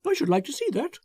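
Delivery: high, bright, self-satisfied chatter; a gushing, smug man showing off his prize; the narrator voices Foster pleased with himself; Foster, eager and pleased. Acted.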